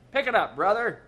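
loud impatient cop snapping; snapped order. A narrator's reading. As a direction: bark at the dawdling driver. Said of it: "snapped a cop".

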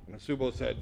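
ordinary delivery

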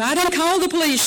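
loud, high, fast shout of warning. A narrator's reading. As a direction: yell it at the intruders. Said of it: "she shouted".

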